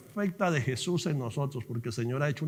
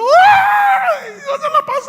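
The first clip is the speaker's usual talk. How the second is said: high, very loud shriek of disbelief; overjoyed scream; 16 dB louder, much slower than usual. Retold or imitated. Preacher reliving the moment he passed the exam, overjoyed scream. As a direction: scream it out, bursting with joy.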